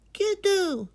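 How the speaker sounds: put-on high, delighted boy's shout of a name; glad cry. Boy greeting his grandfather with joy. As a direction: call out happy to see grandpa. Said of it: "Majid cried".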